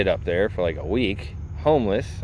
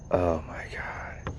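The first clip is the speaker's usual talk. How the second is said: slow breathless whisper; hushed mock panic; whispered dread; 8 dB quieter, much slower than usual. Dreading the approaching belly dancer.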